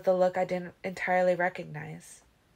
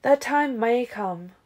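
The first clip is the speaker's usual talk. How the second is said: measured reply; 5 semitones higher, 5 dB louder, slower than usual. Acted. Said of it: "Carlyle replied".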